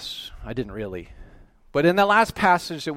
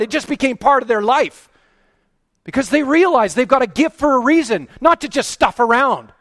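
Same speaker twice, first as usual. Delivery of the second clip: much higher, louder, hammering questions; smacky preaching; 13 semitones higher, 12 dB louder than usual. Real, not acted. Preacher rebuking lazy believers, loud and scolding. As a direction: scold them from the pulpit, building heat. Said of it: "Sorry, a little bit smacky there".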